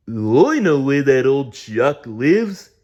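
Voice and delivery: slow, loud, high character voice; big slow bush creature voice; Splodge voice. A narrator's reading. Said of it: "said Splodge".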